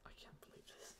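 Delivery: barely audible, stunned whisper; whispered disbelief. Real, not acted. Host realizes the stream went unheard, whispered disbelief.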